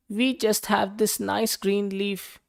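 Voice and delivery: high, slow little ants; ants' voice. A narrator's reading. Ants speaking in story.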